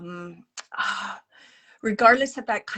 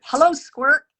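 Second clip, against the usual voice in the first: relayed hello, higher and louder; calling out a greeting; 6 semitones higher, 8 dB louder than usual. Retold or imitated. Medium relays mom's greeting to her child, calling out. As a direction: call out a pet-name hello.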